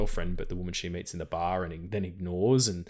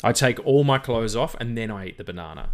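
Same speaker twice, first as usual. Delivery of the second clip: ordinary talk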